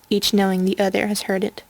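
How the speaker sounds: plain reading voice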